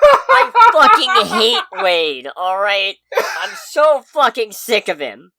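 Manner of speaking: really fed up, bellow it; furious rant. Real, not acted. Podcaster venting about Wade, furious slow shout. Ranting about a hated character.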